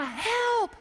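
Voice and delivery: high-pitched drawn-out call; acted cry for rescue; mock screaming. Man still hanging after thirty minutes, high cry for help. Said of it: "he starts screaming".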